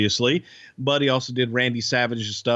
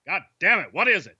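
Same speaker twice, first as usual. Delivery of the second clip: higher, exasperated boss voice; Vince McMahon; 6 semitones higher, faster than usual. Pretend. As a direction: demand it like an impatient promoter. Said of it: "let's freestyle".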